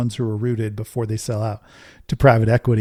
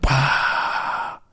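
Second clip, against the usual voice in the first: crowd roar; 2 semitones higher than usual. Retold or imitated. Imitating crowd cheer.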